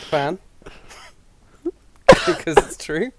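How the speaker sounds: normal talking voice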